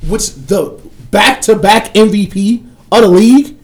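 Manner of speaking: normal talking voice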